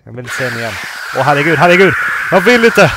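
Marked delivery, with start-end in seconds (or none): 1.2-2.9 loud, high frightened wail; genuinely scared, crying out to stop it; panicked cry. Real, not acted. Panicking at scary game moment.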